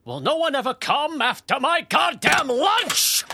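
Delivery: loud exasperated bellow; rant, blowing up at the end; angry rant. Acted. Shopkeeper ranting alone.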